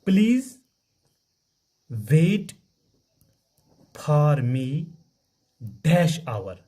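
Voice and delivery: slow, word by word, with long gaps; slow dictation. Shown as a demonstration. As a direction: dictate it slowly to the class. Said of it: "अच्छा".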